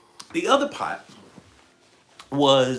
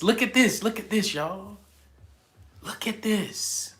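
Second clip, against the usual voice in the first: excited; 7 semitones higher than usual. Real, not acted. Showing off a viewer's name.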